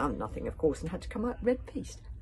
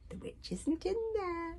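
high, slow dragon voice; Stormfly; 14 semitones higher, slower than usual. A narrator's reading. Dragon daring her friend into the hut, high coaxing. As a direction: coax in a drawn-out high pitch. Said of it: "said Stormfly".

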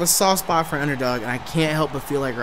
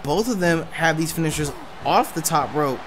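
ordinary talk

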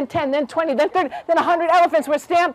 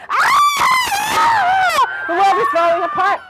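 piercing shriek, then high cry; shriek, then wail the alarm; screaming rabbits; much slower than usual. A narrator's reading. Rabbits screaming ahead of the bears, panicked scream. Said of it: "rabbits who were screaming".

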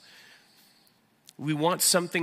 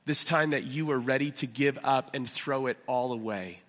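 normal talking voice

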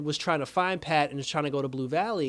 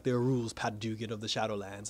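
lower, softer, menacing villain voice; the Shade ominous; 5 semitones lower, 7 dB quieter than usual. Retold or imitated. The Shade warning Pat about the Shadowlands, low and hushed. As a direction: sink the voice low and quiet like a threat. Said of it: "He's like".

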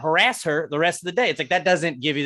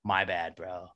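put-on soft, low mumbled drunk apology; hushed, low drunk mumbling sorry; apologetic drunk guy; 8 semitones lower, 12 dB quieter than usual. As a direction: play a sorry, sloppy drunk under your breath. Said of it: "apologetic drunk guy".

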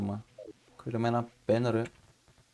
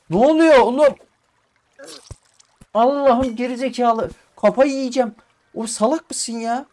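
much higher, much louder exasperated yelling; furious shouting; 12 semitones higher, 9 dB louder than usual. Real, not acted. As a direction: lose your temper at the game. Furious at game, says he'll lose it. (oyuna çıldırmış gibi yüksek perdeden bağırıyor)

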